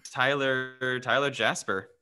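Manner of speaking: normal talking voice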